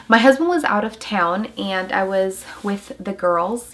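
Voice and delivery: ordinary talk, nothing marked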